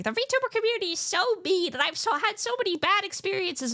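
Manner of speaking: high-pitched mock whining; mocking online complainers. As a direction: whine it in a high, exaggerated put-on voice. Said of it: "people are like".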